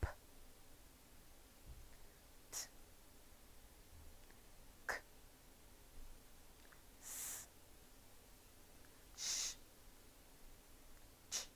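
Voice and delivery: P, t, k, s, sh, ch as short voiceless puffs of air; voiceless consonants. Shown as a demonstration. Teacher has pupils repeat P, t, k, s, sh, ch, voiceless. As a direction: say P, t, k, s, sh, ch with no throat vibration. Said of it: "do not use the voice".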